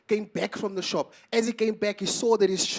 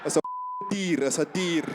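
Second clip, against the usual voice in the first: Afrikaans farmer. Retold or imitated. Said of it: "that's a crazy idea".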